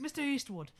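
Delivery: high lightly Irish voice; light stage-Irish greeting; Irish accent. Retold or imitated. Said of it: "he's got the Irish".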